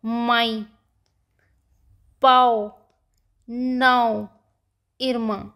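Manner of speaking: माई, पाओ, नाओ, इरमा with nasal endings; one word at a time, माई, पाओ, नाओ, इरमा, each nasalised; nasal vowels. Shown as a demonstration. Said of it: "नाक से बोलना होता है".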